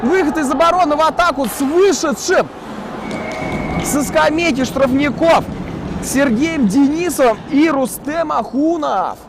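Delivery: high, rising play-by-play build; excited breakaway call. Real, not acted. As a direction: lift up as the attack breaks out. Breakaway from the penalty box.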